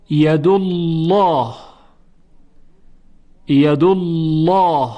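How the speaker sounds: slow "ید الله", heavy dark l in "الله"; full, rounded lam after the damma in "ید الله"; thick lam. Shown as a demonstration. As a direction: recite "ید الله" as a drawn-out "yadullawh".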